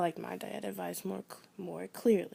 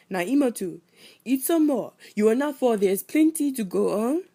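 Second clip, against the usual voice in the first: louder, insistent Sierra Leonean grandpa; Sierra Leone accent; 4 semitones higher, 8 dB louder than usual. Retold or imitated. A grandfather urging his granddaughter to eat more, Sierra Leone accent. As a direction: push more food on her like a loving grandpa. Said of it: "with a slight accent".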